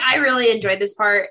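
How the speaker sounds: ordinary talk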